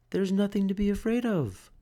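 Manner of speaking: high gentle mother; lightly voiced reassuring mum; mother's voice. A narrator's reading. Mother reassures Franklin, lightly voiced. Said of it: "she would say".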